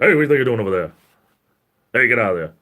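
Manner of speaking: loud, stern dad shout. Retold or imitated. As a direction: yell like a father catching his kid. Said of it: "Making my dad voice".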